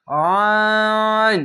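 teacher drawing out আইন slowly; drawn-out Arabic letter. Shown as a demonstration. Teacher showing students the long letter, drawn out. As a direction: stretch the letter name long, three alif. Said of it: "এখানে তিন আলিফ টেনে পড়বেন".

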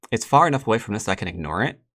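ordinary delivery

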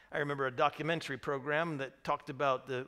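normal talking voice

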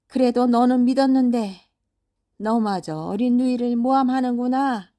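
put-on storybook father banishing his child in fury; angry father scolding. Acted father scolding, banishing third son.

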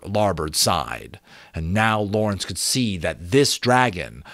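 ordinary delivery, nothing marked

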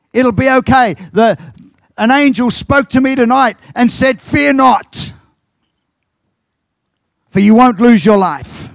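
high, loud fiery preaching; preaching at full force. Acted. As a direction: lift the congregation with Paul's promise. Preacher retells Paul's shipwreck speech.

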